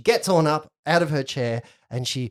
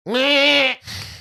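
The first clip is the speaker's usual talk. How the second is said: loud, higher imitated bleat; goat bleat; 12 semitones higher, 6 dB louder than usual. Goat looking up at visitors, loud bleat.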